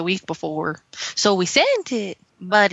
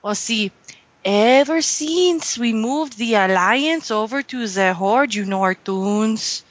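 held mock Mexican accent.

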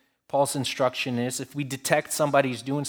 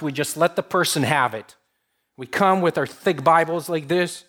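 ordinary talk

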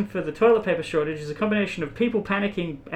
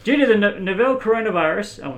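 ordinary talk

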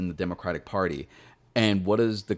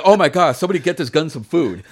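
mocking her; 7 semitones higher, 9 dB louder than usual. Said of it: "And she's like".

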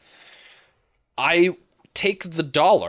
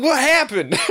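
laughing question; 13 semitones higher, 5 dB louder than usual. Retold or imitated. Asking while fleeing the club.

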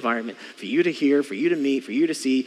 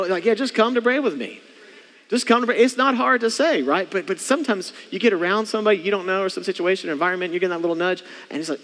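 usual speaking voice

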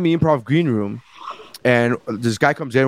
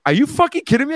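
disbelief; 11 semitones higher, faster than usual. Retold or imitated. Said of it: "and she's like".

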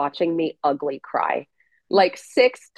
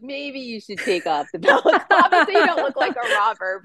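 higher, amused imitation of her husband laughing; quoting husband; 7 semitones higher than usual. Retelling her husband's advice, mock voice with a laugh. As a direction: lightly mimic the husband, half laughing. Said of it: "my husband's like".

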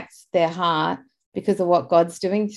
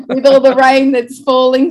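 laughing; 4 semitones higher, 6 dB louder than usual. Real, not acted. Laughing about the rain.

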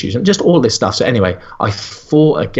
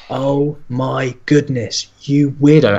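slow, drawn-out, higher-pitched disbelief; appalled disbelief; 5 semitones higher, slower than usual. Retold or imitated. Reacting to nutritionist guarding the butter, appalled. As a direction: stretch it out, mock-appalled.

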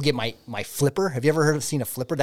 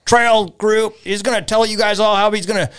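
announcer voice; 8 semitones higher, 3 dB louder than usual. Retold or imitated. Host introduces him.

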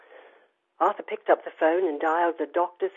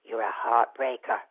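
low man's voice; 6 semitones lower, 4 dB quieter than usual. Retold or imitated.

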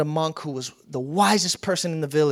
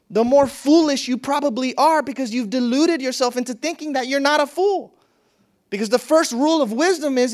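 higher and louder, building to a preacher's peak; preaching at full force; 6 semitones higher, 3 dB louder than usual. Acted. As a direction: drive the point home like a pulpit climax.

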